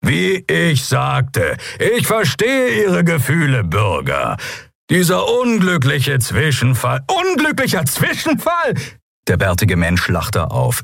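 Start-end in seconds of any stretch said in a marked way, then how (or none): 0.0-7.0 high, slow, projected address; raised placating voice. A narrator's reading. Captain to the citizens, slow raised placating voice. 7.1-8.7 very high, incredulous cry; outraged echo. A narrator's reading. Bearded man echoing captain, outraged disbelief.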